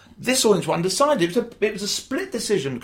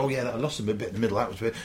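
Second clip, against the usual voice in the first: offhand mumble; 7 semitones lower, 7 dB quieter, faster than usual. Retold or imitated. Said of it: "So they go".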